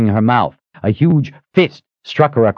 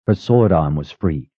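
usual speaking voice

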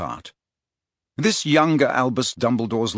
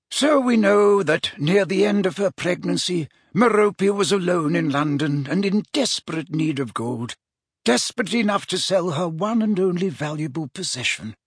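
higher-pitched, deliberate wizard voice; measured storytelling mentor; 7 semitones higher than usual. Acted. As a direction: recount the history like a learned mentor. Wizard lays out his deductions.

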